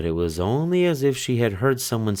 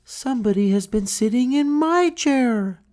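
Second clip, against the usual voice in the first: much higher mother bear voice; middle-sized bear voice. Acted. Mother bear finding her chair used, higher voice. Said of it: "middle-sized bear said".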